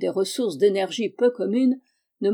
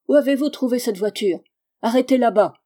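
loud, higher-pitched challenge; yelling; 4 semitones higher, 6 dB louder than usual. A narrator's reading. Cyclist to the men in the carriage, yelling. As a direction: yell it as an angry order. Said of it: "hurla-t-il".

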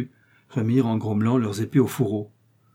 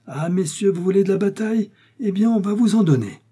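high, raised voice, louder at the end; rousing challenge; 10 semitones higher, 5 dB louder than usual. Acted. D'Artagnan takes up the Englishmen's fight, challenging loudly. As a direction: throw down the gauntlet, pitched well up.

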